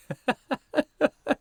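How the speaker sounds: high, ringing roaring laugh; roar of laughter. A narrator's reading. Trevor roaring with laughter at Hughie's sovereign. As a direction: burst out laughing. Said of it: "burst into a roar of laughter".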